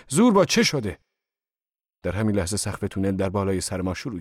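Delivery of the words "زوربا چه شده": high, loud cry of alarm; alarmed shout. A narrator's reading. Narrator yelling to Zorba in the tunnel, alarmed. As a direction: yell out in sudden worry. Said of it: "فریاد کشیدم".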